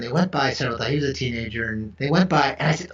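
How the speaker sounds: usual speaking voice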